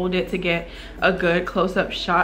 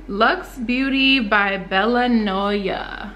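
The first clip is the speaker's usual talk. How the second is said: usual speaking voice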